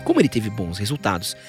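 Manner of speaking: usual speaking voice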